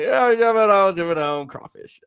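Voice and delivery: high, playful Cajun call; mock Louisiana accent. Shown as a demonstration. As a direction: holler it in a hammed-up Louisiana accent. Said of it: "I say that to my family".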